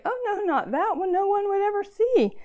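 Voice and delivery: high, loud, pleading refusal with a catch of laughter; quick protest. A narrator's reading. Poem's speaker refusing the Father's task, high and pleading. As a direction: push back fast, a little desperate. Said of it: "I answered quickly".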